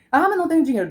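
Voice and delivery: high, loud whiny objection; mock objecting viewer. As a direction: mimic a viewer making excuses. (imitando um espectador reclamando)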